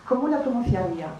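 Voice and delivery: 더 크게 빠르게 묻는 질문. Shown as a demonstration. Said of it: "Una persona que nunca ha estudiado francés".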